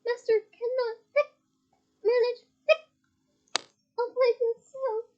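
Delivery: high, pleading house-elf voice; a tiny tipsy elf fretting over her master; high elf squeak; squeaky elf. A narrator's reading. Winky frets.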